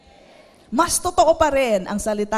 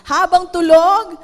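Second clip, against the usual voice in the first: loud emphasis; 4 semitones higher, 9 dB louder than usual. Real, not acted. Repeated loud.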